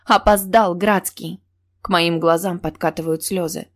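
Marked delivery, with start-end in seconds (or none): none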